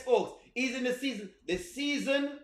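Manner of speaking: ordinary talk, nothing marked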